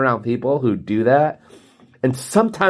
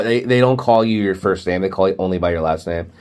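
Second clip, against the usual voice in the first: normal talking voice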